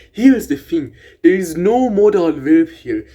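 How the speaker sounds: ordinary talk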